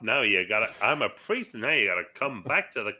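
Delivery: lightly hammed-up vintage American voice; old-time American. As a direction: do a touch of old-movie salesman patter. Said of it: "that age where every American sounded like".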